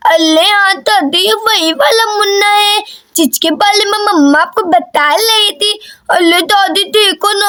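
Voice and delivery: put-on childish baby-talk lisp; lisping little girl. Daisy talks about the baby.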